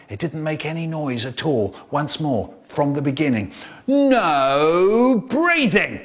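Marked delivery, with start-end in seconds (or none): 3.9-6.0 very long drawn-out, high swooping command; strict teacher. Acted. Teacher standing out the front ordering the class, strict. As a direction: stretch each word like a tyrannical teacher. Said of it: "a teacher who was so strict".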